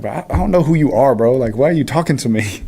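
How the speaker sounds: mock-offended player's comeback; indignant comeback. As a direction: act out snapping back at a heckler. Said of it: "kept yelling at me".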